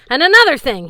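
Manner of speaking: high, loud furious yell; angry sword yelling. Acted. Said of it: "he yelled".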